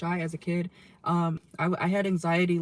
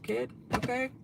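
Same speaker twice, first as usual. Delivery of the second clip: odd slang; 5 semitones higher, 4 dB quieter than usual. Acted. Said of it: "I don't ever talk like that".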